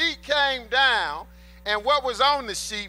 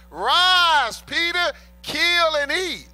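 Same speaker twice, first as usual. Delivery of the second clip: loud, high, slow commanding call; booming voice of the Lord; 7 semitones higher, 6 dB louder, much slower than usual. Acted. Preacher booming God's command to Peter, loud and slow.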